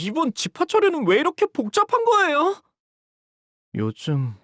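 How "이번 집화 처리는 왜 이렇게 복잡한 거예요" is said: high loud complaining; fed-up grumble pitched much higher; exasperated whine. Acted. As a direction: wail at the tedious paperwork. Frustrated at sorting parcels.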